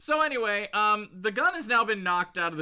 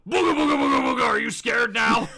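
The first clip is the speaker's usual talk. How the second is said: loud booga-booga mock scare; 8 dB louder, faster than usual. Playfully spooking the other after the bust, mock scare. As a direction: shout it like a silly boo.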